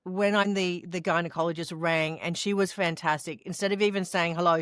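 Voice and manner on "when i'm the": ordinary talk, nothing marked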